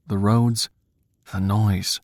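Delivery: plain reading voice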